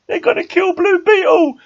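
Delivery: high, loud, slowed wail of dismay; drawn-out high despairing no; anguished cry. Retold or imitated. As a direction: cry it out like your favourite hero is doomed. Realising hero will die.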